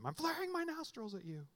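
high, strained pretend scolding; mock-angry parent. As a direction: play a cross parent telling off the kids. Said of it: "let your kids know that you're angry at them".

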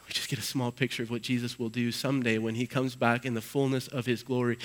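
ordinary talk, nothing marked

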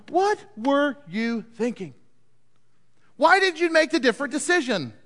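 high-pitched, incredulous questioning; acted exasperation at a foolish choice; exasperated disbelief. Exasperated reader scolds the king.

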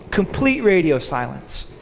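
ordinary talk, nothing marked

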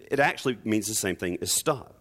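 ordinary delivery

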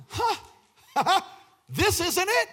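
high-pitched quote breaking into laughter; acted laughing realization. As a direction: imitate a man laughing in the face of death. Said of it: "And he starts laughing".

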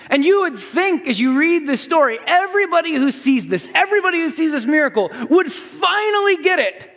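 high, loud, mounting exclamation; excited preaching. Real, not acted. Preacher marvelling at the miracle's witnesses, excited preaching. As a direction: build to an impassioned near-shout.

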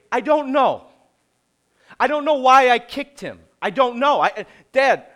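imitating a kid's loud, high protest; exasperated child impression. Children answering dad's why did you do that, mock exasperated protest.

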